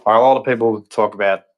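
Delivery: normal talking voice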